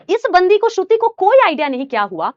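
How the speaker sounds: usual speaking voice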